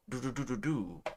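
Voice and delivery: ordinary delivery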